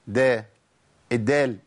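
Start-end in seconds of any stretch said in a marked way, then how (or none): 0.1-0.4 دا as a short open "da"; dal with fatha. Shown as a demonstration. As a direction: sound the d of دا straight into an "a". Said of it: "الدال المتحركة".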